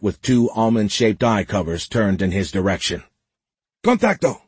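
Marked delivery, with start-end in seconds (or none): 3.8-4.4 high-pitched alarmed yell; yelled warning. A narrator's reading. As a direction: call out an urgent alert in Spanish. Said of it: "the man yelled".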